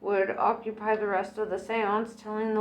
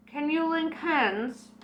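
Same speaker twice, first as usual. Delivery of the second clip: higher, slower medium's voice; a psychic gently gathering her clients; Madame Tracy voice; 7 semitones higher than usual. Acted. Medium asks the circle to link hands, higher and slower.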